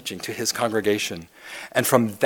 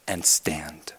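sudden whispered "to stand."; hushed whisper after the build; dramatic whisper; 7 semitones lower, 7 dB quieter than usual. Acted. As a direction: drop to a breath to land the word. Dramatic drop after loud reading.

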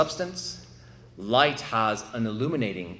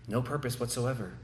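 ordinary talk, nothing marked

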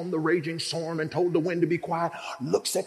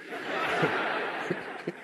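breathless unvoiced laugh of disbelief; amazed laugh; 4 dB quieter than usual. James to John after the storm, laughing. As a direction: laugh in shock.

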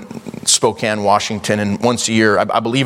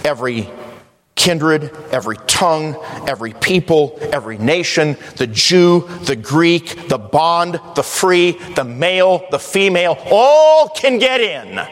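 rhythmic high list building to a long shout; preaching build; 5 semitones higher than usual. Real, not acted. Preacher proclaiming who can get in, building to a drawn-out shout. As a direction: punch each group, then soar on the last line.

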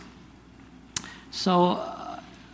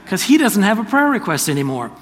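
excited realization; 6 semitones higher, 7 dB louder than usual. Retold or imitated. Sudden realization, laughing excitement.

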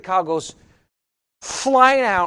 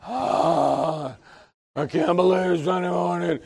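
lower, softer sleepy grumble; weary groaning; 4 semitones lower, 8 dB quieter, slower than usual. Acted. Preacher acts waking on Sunday morning, groaning reluctance. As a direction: groan it out like someone dragged from bed.

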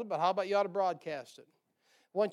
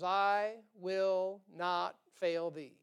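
slow solemn reading; much slower than usual. Acted. Preacher reading the promise slowly.

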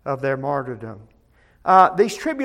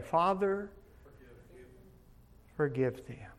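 much softer, hushed plea; quiet plea; 5 semitones lower, 13 dB quieter than usual. Acted. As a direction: breathe it like a dying prayer. Quoting Jesus dying on cross.

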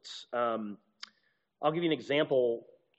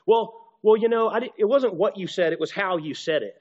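higher, louder exasperated partner, pressing the point; 5 semitones higher, 6 dB louder than usual. Acted. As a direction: sound fed up with the other's tone. Acted quarrel about tone.

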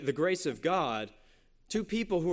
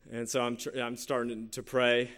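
ordinary delivery

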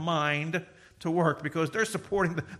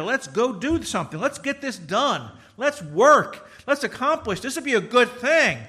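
high loud rallying call; rally the workers to build; hammed-up rallying cry; eager can-do spirit; 7 semitones higher, 7 dB louder than usual. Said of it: "the desire to".

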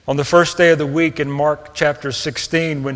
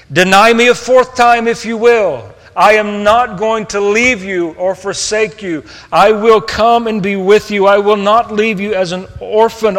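1 impassioned promise; 5 semitones higher, 5 dB louder than usual. Acted. Jesus's bold promise to Peter.

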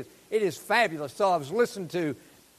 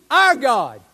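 Our punched loud and falling; stress on our; 2 semitones higher, 9 dB louder than usual. Shown as a demonstration. Preacher stressing whose God it is, Our punched loud. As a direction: hit the word Our hard. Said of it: "not a God, our God".

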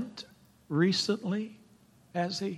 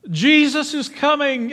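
excited news; 9 semitones higher, 6 dB louder than usual. Acted. Crowd member telling blind man.

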